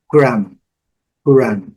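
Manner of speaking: gram said with lips closing on the final M; teacher modelling gram, no B sound; English gram demo. Shown as a demonstration. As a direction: close the mouth on the M of gram. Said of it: "Baixando a boca na letra M".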